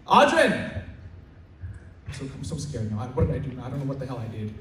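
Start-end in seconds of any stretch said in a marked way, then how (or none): 0.1-0.5 sudden loud, high call of a name; teacher calling out. Retold or imitated. Teacher suddenly calling the student from the basin area, loud call. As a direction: call across the room out of nowhere. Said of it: "Out of nowhere".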